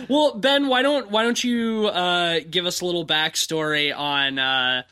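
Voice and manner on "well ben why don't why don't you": ordinary talk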